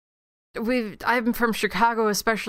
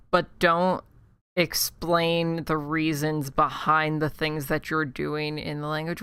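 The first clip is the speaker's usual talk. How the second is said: usual speaking voice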